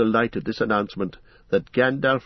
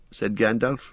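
ordinary delivery, nothing marked

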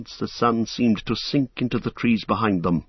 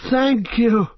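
hobbits' thanks; 11 semitones higher, 3 dB louder than usual. Acted.

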